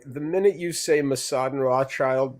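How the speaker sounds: normal talking voice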